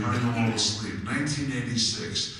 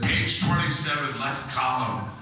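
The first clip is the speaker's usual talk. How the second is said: higher, louder mom voice; mother's instructions; 5 semitones higher, 4 dB louder than usual. Retold or imitated. Mother pointing son to the page, lightly raised. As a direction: direct him like a proud mother. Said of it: "She said".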